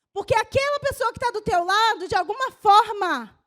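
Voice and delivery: loud, driving sermon climax; fiery preaching. Real, not acted. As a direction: preach it hard to the church. Pastor preaching at full force. (pregação inflamada e forte)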